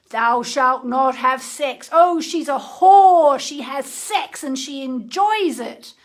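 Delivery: slow, loud, hammed-up scandalized preacher; mock preacher condemning. Mocking the patriarchy's condemnation of women, loud slow preacher voice. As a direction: thunder it like a moralizing priest.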